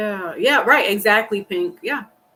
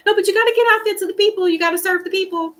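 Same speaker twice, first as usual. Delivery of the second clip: high, hurried angel nagging; little angel; 9 semitones higher, faster than usual. Acted. Little angel urging her to go serve the people, high insistent voice. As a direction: urge them on as the little angel, pitched up and quick.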